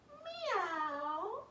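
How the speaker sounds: high, loud meow; cat meow. Shown as a demonstration. Teacher leading children in cat pose, high meow. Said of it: "Let's move through the pose together".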